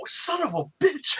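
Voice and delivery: slow, high, hushed disbelief; slow stunned oath; stunned curse. Retold or imitated. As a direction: drag out the shock. Learns Rodney is eighteen.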